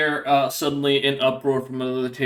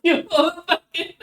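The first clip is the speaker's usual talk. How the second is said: laughing; 13 semitones higher, slower than usual. Real, not acted. Player cracks up.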